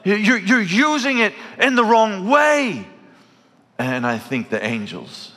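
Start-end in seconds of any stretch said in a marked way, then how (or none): 0.0-2.9 high pleading cry, slowed; anguished cry. Pretend. Preacher voicing the heart's anguished cry. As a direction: cry out that it is all wrong. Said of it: "the cry of your heart is".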